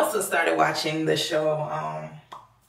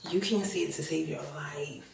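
whispering; 7 dB quieter than usual. Real, not acted. Serious point about toxic love.

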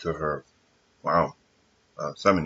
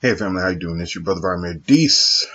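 ordinary talk

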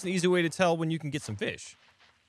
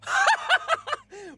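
shocked laugh; 3 dB louder than usual. Real, not acted. Laughs after trap death.

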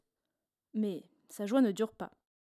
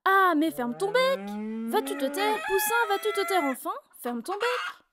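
shouting chorus; 7 semitones higher than usual. A narrator's reading. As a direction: shout the chick down together. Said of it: "se mettent à crier".

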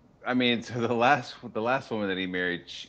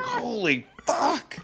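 high, slow terrified cry; mock scared kid, high and slow; mock terror; much slower than usual. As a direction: shriek in hammed-up panic. Scared kid seeing stepmom coming.

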